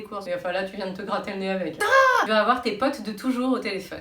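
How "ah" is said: startled cry. Acted. Scratched nose with glove.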